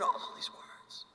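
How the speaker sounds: hushed mock-shocked whisper; scandalized whisper. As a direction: drop into a whisper over the forbidden part. Retelling a small child's swearing.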